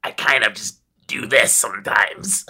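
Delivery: light take on her character voice; a hint of an older character voice; imitating narrator voice. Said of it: "That's not the exact same".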